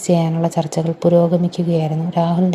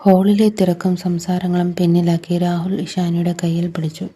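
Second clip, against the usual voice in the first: ordinary delivery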